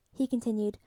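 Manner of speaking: plain reading voice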